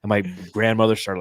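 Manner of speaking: ordinary delivery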